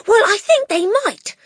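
put-on high, loud excited child; crying out. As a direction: cry it out hopefully. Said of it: "Matilda cried".

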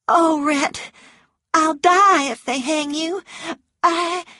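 high, loud distressed woman; anguished pleading. A narrator's reading. Scarlett tells Rhett she'll die if he hangs, anguished. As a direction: plead dramatically, breaking off at the end.

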